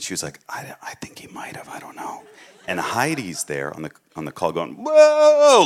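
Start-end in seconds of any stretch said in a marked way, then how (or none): 0.5-2.2 imitating a soft unvoiced whisper. Sister whispering back during the Zoom call. 4.7-5.6 imitating a loud, much higher whoa; excited whoa. Heidi exclaiming on the call, amazed and loud.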